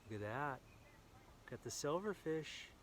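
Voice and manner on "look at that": usual speaking voice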